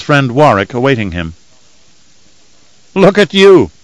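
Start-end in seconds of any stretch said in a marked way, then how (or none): 2.9-3.7 bright, raised greeting with a laugh. A narrator's reading. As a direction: greet an old friend with a laugh. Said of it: "the taller Freeman said with a laugh".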